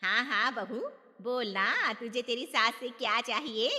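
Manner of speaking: loud indulgence. Acted. Aunt loudly indulgent.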